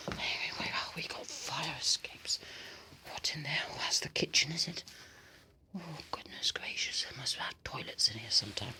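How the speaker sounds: hushed whisper; soft sneaking whisper. Real, not acted. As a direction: whisper as you look around. Whispering inside the house.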